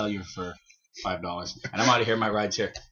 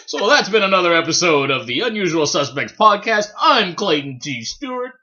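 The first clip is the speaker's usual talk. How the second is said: podcast host voice; 4 semitones higher, 4 dB louder than usual. Acted. Host signing off the podcast to listeners, announcer voice. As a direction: announce the show's close like a radio host. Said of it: "Here comes the voice".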